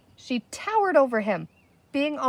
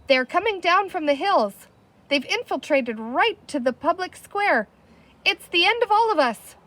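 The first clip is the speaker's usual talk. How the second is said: higher, louder rising panic; panicked; 8 semitones higher, 3 dB louder than usual. A narrator's reading. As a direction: wail it like everyone is doomed. Panicking chief.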